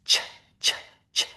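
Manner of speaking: voiceless ch, air only; crisp ch with no voice; ch sound. Shown as a demonstration. Teacher showing ch without voice. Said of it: "Turn off your voice box".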